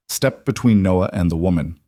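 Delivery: plain reading voice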